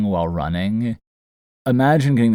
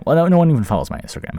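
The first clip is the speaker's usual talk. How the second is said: self-mocking; 9 semitones higher, 5 dB louder, much faster than usual. Real, not acted. Self-deprecating aside.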